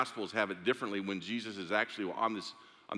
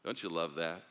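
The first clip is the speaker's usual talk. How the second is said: usual speaking voice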